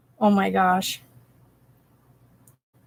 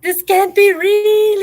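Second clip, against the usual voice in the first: high, slow, thrilled squeal; excited disbelief; 10 semitones higher, 6 dB louder, slower than usual. Real, not acted. Overjoyed disbelief at news.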